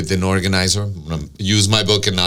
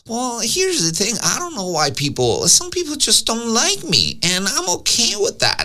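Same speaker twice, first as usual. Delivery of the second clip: much higher, put-on voice of a breezy doctor; Doctor Joe impression; 13 semitones higher than usual. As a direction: mimic Doctor Joe lightly shrugging it off. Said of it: "I can do a great Doctor Joe impression".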